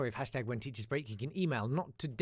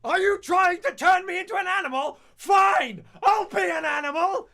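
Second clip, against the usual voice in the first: much higher, much louder furious shout; teacher shouting; 14 dB louder than usual. Retold or imitated. Teacher snapping at pranking class, furious high shout. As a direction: explode like a teacher losing it. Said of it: "He then proceeded to shout".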